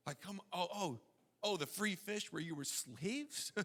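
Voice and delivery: preacher ridicules longing for slave food, hushed sarcasm; sarcastic disbelief. Real, not acted. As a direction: scoff quietly at the absurdity. Preacher mocks Israelites' nostalgia.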